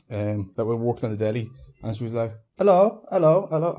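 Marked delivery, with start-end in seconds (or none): 2.6-3.7 high, loud attempt at a Polish accent; bad Polish accent. Polish deli woman to stung bin man, botched Polish accent. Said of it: "I can't do a Polish accent".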